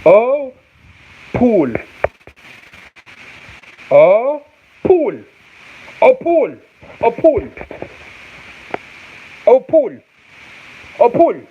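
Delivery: high "Oh", falling "pool"; mock phonics voice on "Oh, pool"; exaggerated sounding-out. Shown as a demonstration. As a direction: pitch "Oh, pool" way up and swoop down on "pool". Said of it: "How do you say it".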